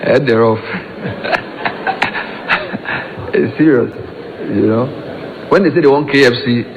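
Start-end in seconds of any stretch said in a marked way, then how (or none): 0.7-3.3 real breathy chuckle, trailing on; amused laugh. Real, not acted. Host joking with guests about local food, amused laugh.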